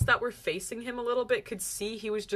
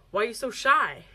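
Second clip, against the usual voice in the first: louder mock-demeaning question; 7 dB louder than usual. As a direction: imitate someone talking down to shy strangers. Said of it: "demeaning way".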